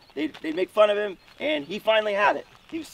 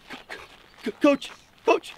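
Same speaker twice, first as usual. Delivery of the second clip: mock-crying stammer, stuck on the word; imitated choked up; 5 semitones higher than usual. Crying kid trying to address his coach, imitated choked stammer. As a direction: act out a kid too choked up to speak. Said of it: "he's kinda crying, he's choked up".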